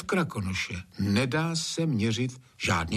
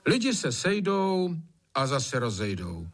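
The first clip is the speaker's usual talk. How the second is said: dismissive; 5 semitones higher than usual. A narrator's reading. Kuba dismisses master's whining.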